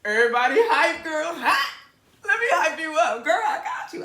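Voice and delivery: loud, high excited hype-up. Real, not acted. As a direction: shout it like you're hyping a crowd. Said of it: "And I am".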